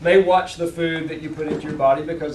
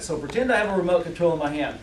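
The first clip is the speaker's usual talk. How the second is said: usual speaking voice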